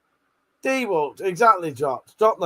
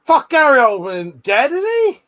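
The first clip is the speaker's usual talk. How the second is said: loud startled outburst, the question jumping high; real shout; 8 semitones higher, 8 dB louder than usual. Real, not acted. Streamer shocked at rumour Gary Oldman died, real loud shout. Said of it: "Sorry, I just shouted that".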